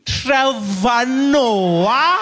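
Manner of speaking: high loud very slow question; a receptionist amazed to see the comedian; drawn-out disbelief. Retold or imitated. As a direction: stretch it out in disbelief. Nurse disbelieving.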